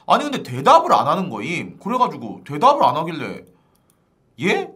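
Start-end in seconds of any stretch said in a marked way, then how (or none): 4.4-4.7 "예?" jumping high as a question; 되묻는 예?. Retold or imitated. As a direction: ask back "예?" in a high rising tone. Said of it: "라고 물어보니까". ("예?" 높은 톤으로 되묻기)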